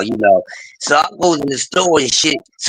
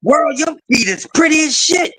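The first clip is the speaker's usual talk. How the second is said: imitating woman; 10 semitones higher than usual. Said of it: "She said".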